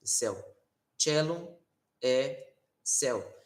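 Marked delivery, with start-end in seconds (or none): 1.0-1.6 Cellum with a ch sound, like Italian; ecclesiastical Latin. Shown as a demonstration. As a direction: start Cellum with ch as in teacher. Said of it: "O C pronuncia como teacher".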